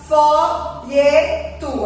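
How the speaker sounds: very slow, drawn-out "folheto"; measured teacher demo of "folheto", each syllable apart. Shown as a demonstration. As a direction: spell out "folheto" slowly by syllables. Teacher reads word syllable by syllable.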